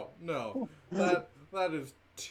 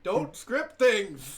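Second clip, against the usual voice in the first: slow, loud, raised exclamation; playful scolding; 4 semitones higher, 7 dB louder, much slower than usual. Real, not acted. Player telling the game master off, playful loud scolding. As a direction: draw it out loud and higher.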